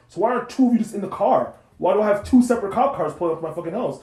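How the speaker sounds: loud, exasperated questioning; indignant. Retold or imitated. Driver confronting cop over two cruisers, indignant. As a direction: demand answers, pitch pushed up. Retelling argument with police.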